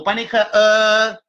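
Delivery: ordinary talk, nothing marked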